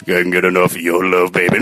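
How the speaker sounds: deep gravelly love-song line; low harsh voice. Shown as a demonstration. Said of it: "You've got the deep gravelly tone".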